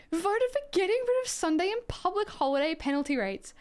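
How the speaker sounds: high, disbelieving re-read of the vote; incredulous reread. Real, not acted. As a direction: read it back, aghast. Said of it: "Oh my God".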